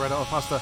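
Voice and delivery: Portuguese name said in an American accent, "Red Hot Pasta"; butchered Portuguese name. Real, not acted. Commentator calling the corner, self-mockingly butchering it. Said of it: "a Portuguese name in my horrible, horrible American accent".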